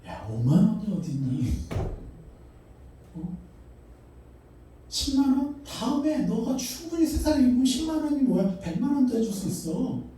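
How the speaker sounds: put-on a doting father lifting the pitch to cheer his child on; God's encouraging voice. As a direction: voice God as a proud, generous father. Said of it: "하나님은 뭐라는지 아세요?".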